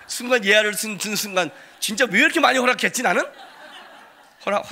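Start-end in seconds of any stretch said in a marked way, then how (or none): 0.1-3.2 talking through laughter; laughing. Real, not acted. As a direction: laugh at yourself about it.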